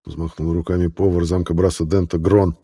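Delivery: ordinary talk, nothing marked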